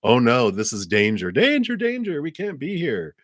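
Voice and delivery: high, mock-panicked alarm, pitch leaping up on danger; panicky alarm. Subconscious warning her of danger, mock panic. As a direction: sound the alarm like a frightened inner voice. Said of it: "my little subconscious side was like".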